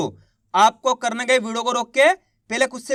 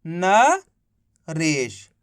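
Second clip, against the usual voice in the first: slow drawn-out word; 5 semitones lower, much slower than usual. Shown as a demonstration. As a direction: stretch Naresh out very slowly. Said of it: "तो मिलके बन गया". (नरेश बहुत धीरे खींचकर)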